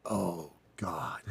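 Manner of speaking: hushed, sinking oh God; dismayed whisper. Retold or imitated. Man realising his date hates sushi, dismayed whisper.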